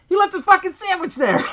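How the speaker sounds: high, loud incredulous shout; outraged disbelief. Real, not acted. Outraged at the scientist's sandwich.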